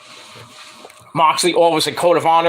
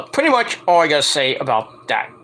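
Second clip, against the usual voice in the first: usual speaking voice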